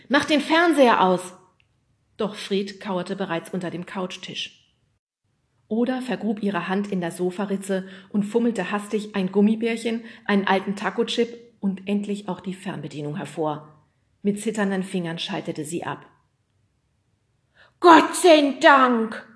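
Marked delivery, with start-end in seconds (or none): 0.1-1.3 high, loud command; urgent call. Acted. Nemo wants the television off, urgent shout. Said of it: "rief Nemo den anderen zu". 17.8-19.3 loud, high, very drawn-out creature voice; relieved yeti groan. Acted. Yeti after television goes off, relieved groan. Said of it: "Erleichtert stöhnte der Yeti auf".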